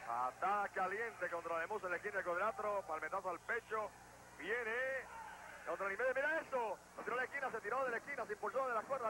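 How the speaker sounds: loud, high, rising excitement; excited play-by-play. Real, not acted. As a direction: shout the finish to the fans. Excited finish of match.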